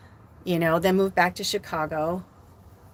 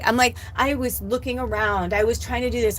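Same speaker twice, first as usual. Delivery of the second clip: usual speaking voice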